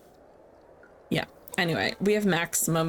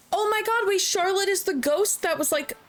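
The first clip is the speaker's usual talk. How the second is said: much higher, excited realization; surprised; 5 semitones higher than usual. Real, not acted. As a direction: burst out as it suddenly clicks. Realizes grave is the ghost's.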